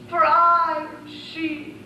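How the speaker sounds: slow, drawn-out declaration; slow regal proclamation; grand reveal. Acted. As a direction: announce yourself like royalty. Princess announces herself.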